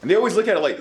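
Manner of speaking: ordinary delivery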